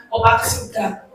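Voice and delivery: ordinary talk, nothing marked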